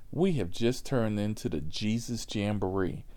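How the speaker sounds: normal talking voice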